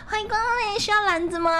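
high sing-song clerk call; shop greeting call. Shown as a demonstration. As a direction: greet customers like a cashier. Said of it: "我超会喊的啦".

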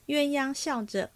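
ordinary delivery, nothing marked

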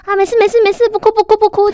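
put-on high, loud hushing of a crying baby; sing-song, loud soothing of a baby; hushing a crying child. Others hushing a crying baby, high and loud. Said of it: "以前很多人就会说".

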